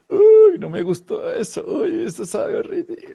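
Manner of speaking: high, loud disgusted "uy" then a grimacing voice; disgusted recoil. Acted. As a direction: recoil in mock disgust at a bad taste. Ejemplo de aversión, asco. (asco exagerado, agudo)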